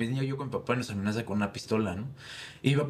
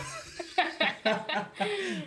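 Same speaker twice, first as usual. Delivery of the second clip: high, long burst of laughter; high cackling laugh. Real, not acted. Laughing at the penalty joke, high cackle.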